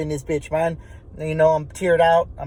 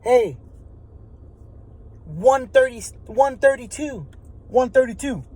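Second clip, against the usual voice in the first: retold yelling down; 5 semitones higher than usual. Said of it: "he yells down and he says".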